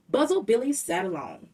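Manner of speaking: ordinary delivery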